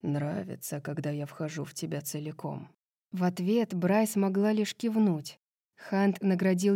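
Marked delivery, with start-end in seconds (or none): 0.0-2.7 low, hushed lover's question; low, soft bedroom voice; intimate murmur. A narrator's reading. Lover asks during sex.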